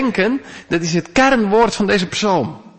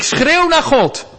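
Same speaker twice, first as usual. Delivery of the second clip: pitched much higher, slow and strained, a cry of distress; a preacher's loud cry to heaven; crying out to God; 10 semitones higher, 3 dB louder, slower than usual. Shown as a demonstration. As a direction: call out to God as if in trouble. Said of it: "En het schreeuwen naar God".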